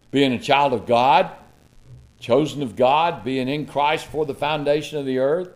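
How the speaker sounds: normal talking voice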